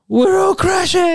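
very high, slow panicked shout. Retold or imitated. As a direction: scream it as the plane goes down. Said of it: "it's like".

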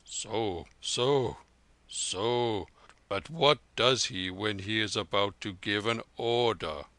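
put-on an old veteran pressing for the detail; old soldier.

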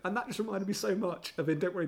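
usual speaking voice

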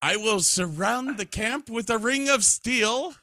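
hammed-up TV officer, indignantly demanding; Major Hochstetter. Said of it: "for several catchphrases, including".

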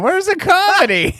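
mock listener complaint. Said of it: "people are listening to the intro saying".

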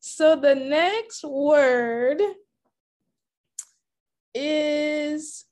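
slow, stretched words with a long pause; suspenseful reveal. Real, not acted. Host announcing the next word to player, slow suspenseful build. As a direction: hold off the reveal, drawing it out.

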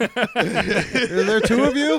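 hammed-up amazed shout; mock shock. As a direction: gasp it out at the size. Mock scale operator shocked.

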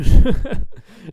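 sudden loud laugh; burst of laughter. Real, not acted. Host cracks up at a listener's joke, bursting laugh.

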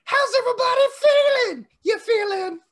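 high, loud rock-star crowd call. Retold or imitated. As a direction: shout it high like a stadium frontman. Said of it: "I can do the".

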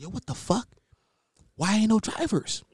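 high, hushed exasperated complaint; retold exasperation. Retold exasperation at ride app.

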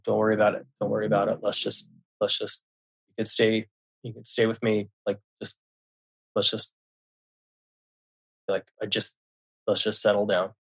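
soft, hushed reassurance; calming. Retold or imitated. Father to his shaken son upstairs, calming. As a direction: retell soothing a shaken child, softly and haltingly. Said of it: "And I calmed him down".

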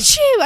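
loud, high mock achoo; dramatic fake sneeze. Shown as a demonstration. Podcaster showing her attention-seeking fake sneeze, dramatic and loud. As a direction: sneeze it out big for show. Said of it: "intentionally just to be dramatic".

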